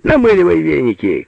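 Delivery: high-pitched shout falling away; shouted order. A narrator's reading. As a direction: call out a brisk command. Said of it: "кричал Алёша". (громкий командный крик)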